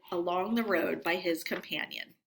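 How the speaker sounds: usual speaking voice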